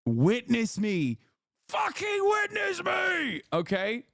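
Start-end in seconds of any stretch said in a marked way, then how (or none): 1.7-3.4 much higher, screamed war cry; war cry shout. Pretend. Content warlord screaming to his viewers. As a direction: bellow it like a crazed warlord. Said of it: "I am a goddamn content warlord".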